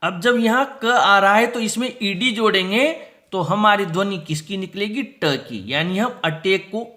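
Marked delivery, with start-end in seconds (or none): none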